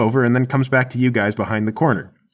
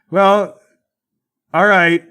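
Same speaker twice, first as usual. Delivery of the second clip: high, raised grudging agreement; loud giving-in; 8 semitones higher, 5 dB louder, much slower than usual. Acted. Mike agreeing to disarm after a pause, loud and grudging. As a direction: call back your reluctant okay. Mike gives in, calling back.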